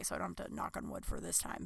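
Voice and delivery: whispered aside under the breath; muttered aside. Real, not acted. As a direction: say it almost unvoiced, to yourself.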